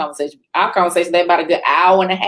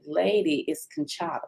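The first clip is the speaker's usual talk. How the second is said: hushed, lowered aside to an irritating colleague; unimpressed correction; 2 semitones lower, 10 dB quieter than usual. Retold or imitated. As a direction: correct her quietly, dropping the volume. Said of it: "looking at her like".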